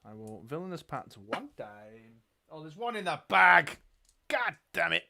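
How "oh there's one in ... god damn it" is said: loud, higher-pitched cry of annoyance; exasperated yell at the game; frustrated outburst. Real, not acted. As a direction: curse your luck loudly. Frustrated at die in bag.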